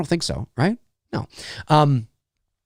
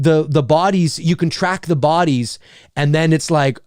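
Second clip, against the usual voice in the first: ordinary talk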